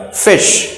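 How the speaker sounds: फिश with a brief ih; short vowel sound. Shown as a demonstration. Teacher points out the tiny sound, short-vowel फिश. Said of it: "sound बहुत छोटा है".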